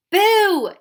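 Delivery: a big loud high boo; yelled. A narrator's reading. Tom scaring a passing Sidewinder, a loud boo. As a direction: shout it to scare the creature. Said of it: "he yelled".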